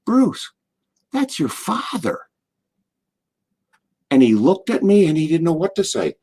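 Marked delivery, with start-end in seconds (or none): 0.0-2.2 high, soft, pained reproach; hushed, pitched-up admonishment; channeling his dad. Retold or imitated. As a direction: scold gently like a father appalled. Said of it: "is my dad".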